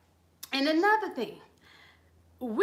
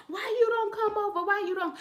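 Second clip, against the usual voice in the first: fake concerned relative; 7 semitones higher than usual. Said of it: "they'll be like".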